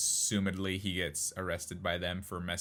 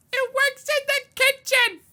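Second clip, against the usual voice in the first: much higher, louder, slow hollering mom; high slow shout of a mom on the phone; mom's shouted delivery; 12 dB louder than usual. Retold or imitated. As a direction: holler it from across the house like a proud mom. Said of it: "the delivery was".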